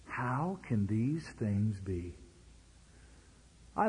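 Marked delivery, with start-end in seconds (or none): 0.1-2.2 slow, soft bewildered question; puzzled Nicodemus. Retold or imitated. Preacher voicing baffled Nicodemus, slow and soft. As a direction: let Nicodemus drag out his confusion. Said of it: "Nicodemus answered and said".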